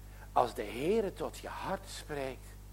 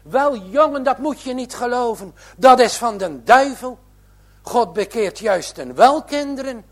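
higher, much louder grandmother protesting; startled, forceful grandmother; 8 semitones higher, 13 dB louder than usual. A narrator's reading. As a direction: strike the table and insist hard. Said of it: "De oude vrouw schrikt en slaat met haar hand op de tafel".

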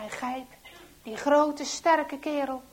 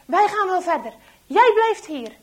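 loud, raised soldier's command; barked order; 7 semitones higher, 14 dB louder than usual. A narrator's reading. Soldier orders the traitor to stay, barked loud. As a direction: order a subordinate sharply and loudly.

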